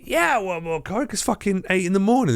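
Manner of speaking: put-on high, eager drunken yes, sending yourself up; mock eager. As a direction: overplay your keenness, pitched high. Agrees to more coke, mocking self.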